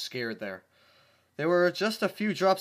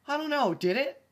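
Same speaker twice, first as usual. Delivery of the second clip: much higher, squeaky mock answer; 13 semitones higher, 6 dB louder than usual. Reader teasing the fanfic's question in a squeaky voice. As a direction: answer the story in a silly mock voice.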